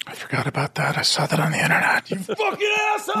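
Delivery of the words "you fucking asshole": high, fast shouted insult; mock outraged shout. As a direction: yell it in fake outrage.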